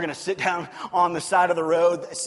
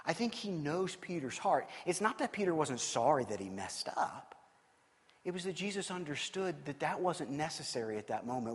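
ordinary delivery

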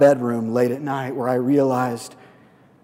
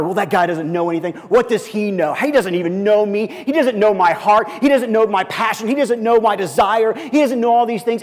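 higher, louder, rising indignant rant; bitter resentment; 8 semitones higher, 6 dB louder than usual. Retold or imitated. Pastor retelling his resentful thoughts, rising indignation. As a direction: build the grudge line by line, climbing higher. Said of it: "This dishonor".

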